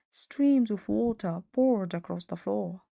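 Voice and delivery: plain reading voice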